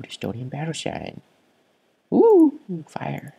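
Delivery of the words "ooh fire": excited. Real, not acted. Excited discovery.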